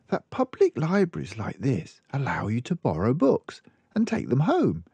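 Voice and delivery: lightly voiced librarian frowning; concerned librarian. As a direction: librarian mildly worried for the child. Librarian tells news.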